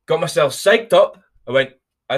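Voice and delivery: ordinary delivery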